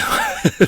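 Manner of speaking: breathy burst of laughter; high laugh. Real, not acted. Laughs apologizing for accent.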